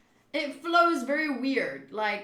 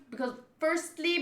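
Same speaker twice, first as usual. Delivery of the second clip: plain reading voice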